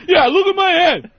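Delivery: high, loud, enraged bellow; furious yell. Retold or imitated. Bald man screaming back at the clown, high loud rage. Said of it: "this fucking guy is just losing".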